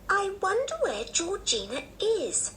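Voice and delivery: put-on very high-pitched curious child; wondering little girl. A narrator's reading. Anne at good night to the boys, high wondering child. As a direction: wonder aloud in a high girlish voice.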